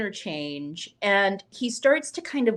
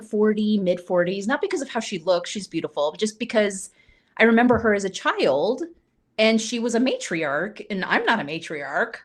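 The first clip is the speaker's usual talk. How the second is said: usual speaking voice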